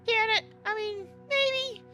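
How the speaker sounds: high squeaky mock-uncertain voice; mock doubt. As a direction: jump way up in pitch, feigning second thoughts. Joking doubt about his own claim.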